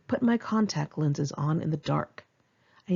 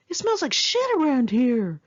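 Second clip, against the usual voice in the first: put-on drunk friend; 7 semitones higher, 5 dB louder than usual. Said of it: "Anthony was still so drunk that he said".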